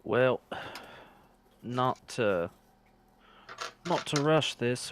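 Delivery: put-on lightly slipping into a Scottish accent by mistake; a hint of misplaced Scottish accent; slipped Scottish accent. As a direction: attempt at a character voice, drifting Scottish. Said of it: "that's the wrong accent".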